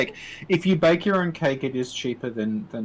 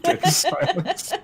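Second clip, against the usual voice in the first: deadpan joke; 3 dB louder, much slower than usual. Acted. Joking about no questions.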